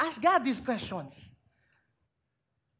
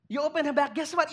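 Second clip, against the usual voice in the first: normal talking voice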